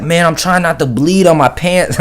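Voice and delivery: high, strained, oddly calm bleeding friend; Miguel. Retold or imitated. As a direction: whine it up high through the pain. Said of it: "He's like".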